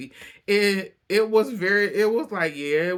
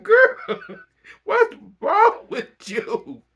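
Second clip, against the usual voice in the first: loud high squeal, stretched out slowly; amused disbelief; 8 dB louder, much slower than usual. Real, not acted. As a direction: cry out incredulously while cracking up. Reacting to Lisa, cracking up.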